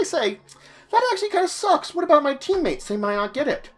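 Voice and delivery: high, whiny objecting player voice; mock complaining viewer. Viewer objecting to the goblin-only feat, whiny and high. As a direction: play a skeptical player griping about teammates. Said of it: "Now you say".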